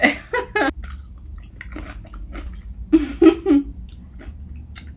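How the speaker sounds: long wheezing silent laugh; breathless laughter. Real, not acted. Reader laughing at the pickle scene, wheezing laugh. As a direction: laugh until no sound comes.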